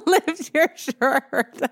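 slow, laughing tease; giggling through the words. Real, not acted. Teasing laugh at his abs.

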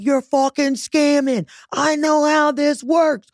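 high, drawn-out mock outrage; hammed-up righteous outrage; indignant accusation. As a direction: accuse the scammer, slow and high-pitched. Retells own accusation of the caller.

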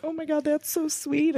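high, soft, choked-up gush; teary and touched. Real, not acted. Host moved by guest's story, teary and touched. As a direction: let it catch in your throat, touched. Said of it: "I'm gonna cry".

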